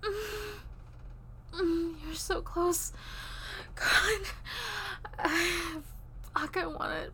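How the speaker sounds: rising breathy moans, loud and intense; breathless moaning. Acted. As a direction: let the pleasure pour out in gasps. Lovers in intimate roleplay scene.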